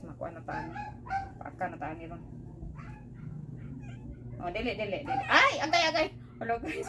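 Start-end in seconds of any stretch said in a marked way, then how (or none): 0.0-2.2 breathy whisper to herself. Real, not acted. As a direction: whisper it under her breath. Struggling with pet harness.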